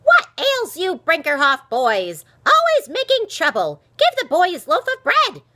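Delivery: high, very loud scolding; old woman's sharp, angry shout; sharp call. A narrator's reading. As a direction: scold the troublemakers across the street. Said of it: "called out sharply".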